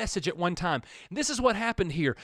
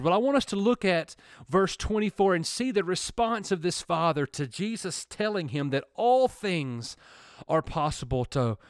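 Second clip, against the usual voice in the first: usual speaking voice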